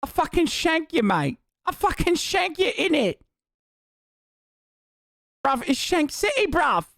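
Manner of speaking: high-pitched, hammed-up angry Brit; mock British accent. Offended Brit threatening to shank the streamer, mock British accent. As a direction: escalate into absurd high British outrage. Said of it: "I'm doing a British accent".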